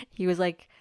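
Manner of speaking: ordinary talk, nothing marked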